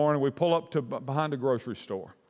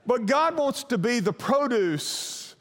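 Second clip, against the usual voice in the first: slow, raised, emphatic preaching; 6 semitones higher than usual. Real, not acted. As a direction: land the sermon point. Sermon point after the story.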